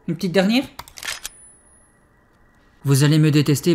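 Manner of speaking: ordinary delivery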